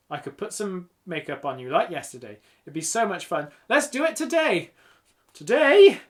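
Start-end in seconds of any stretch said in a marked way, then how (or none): 3.7-4.7 higher, louder burst of enthusiasm; eager urging. A narrator's reading. Eager friend pushing her plan. 5.3-6.0 high, loud incredulous question; startled disbelief. A narrator's reading. Startled by the sudden plan.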